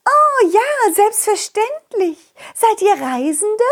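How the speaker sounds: high, slow princess voice. Acted. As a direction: play a sweet, posh princess. Princess character voice.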